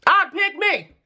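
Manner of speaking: high, excited squeal. Real, not acted. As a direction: say it with delight. Excited self-choice.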